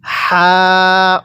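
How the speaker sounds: long-held হা with a light puff of air; airy হা. Shown as a demonstration. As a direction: let light breath out through the mouth on হা. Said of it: "হালকা বাতাস বের করব". (হালকা বাতাস ছেড়ে হা)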